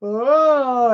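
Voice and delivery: imitated long moan; imitated eerie moan. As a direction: let a wailing "oh" swell, imitating her. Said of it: "And she goes".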